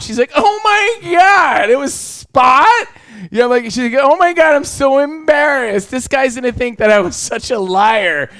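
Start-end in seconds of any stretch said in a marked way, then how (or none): 0.4-2.7 high, slow, shocked "Oh my God"; shocked squeal. Retold or imitated. Jordan shocked about Spot. 4.0-8.3 put-on embarrassed girlfriend. Jordan embarrassed.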